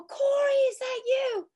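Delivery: calling to her brother on the beach. Retold or imitated. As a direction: call out, hoping it's him.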